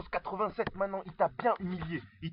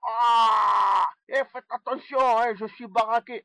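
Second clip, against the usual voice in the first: high, loud mocking impression with stretched line endings; pitched-up, shouty boaster, badly puffed-up bravado; 7 semitones higher, 4 dB louder than usual. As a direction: ridicule a rival rapper in a high, hammed-up southern French accent. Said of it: "je vais essayer de t'imiter".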